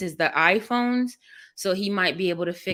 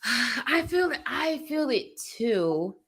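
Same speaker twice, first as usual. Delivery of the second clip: pitched-up, slow sympathy; 5 semitones higher, slower than usual. Real, not acted. Commiserating with her fussing baby.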